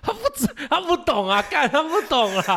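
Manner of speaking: high laughing disbelief. Real, not acted. Friend laughing at the girlfriend's reaction. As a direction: laugh it out, he doesn't get it.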